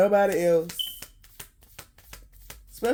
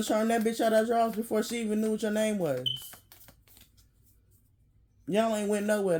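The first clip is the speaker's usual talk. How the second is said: normal talking voice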